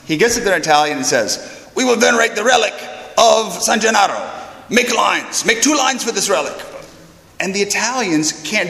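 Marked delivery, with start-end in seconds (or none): 1.8-6.5 higher-pitched, officious command; stern Germanic priest. Retold or imitated. Monsignor orders the unruly crowd into lines, stern and Germanic. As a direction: announce it as a rigid order to the crowd. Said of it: "he seems like a German".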